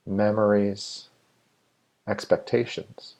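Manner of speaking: measured meditation guide with long, spacious pauses; guided meditation. Real, not acted. Meditation leader inviting listeners to settle and let go, guided meditation. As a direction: lead listeners unhurriedly, phrase by phrase, leaving room to breathe.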